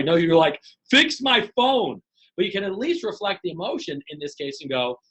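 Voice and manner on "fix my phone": higher, drawn-out customer demand; impatient demand. Acted. Customer cuts off phone company's apology, impatient demand. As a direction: stretch each word into a pointed demand.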